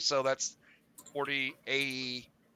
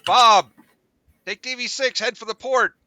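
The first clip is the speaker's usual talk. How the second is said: loud urgent call over comms; urgent comms alert; 8 semitones higher, 9 dB louder than usual. Acted. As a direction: bark the alarm to the crew. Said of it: "and on all comms".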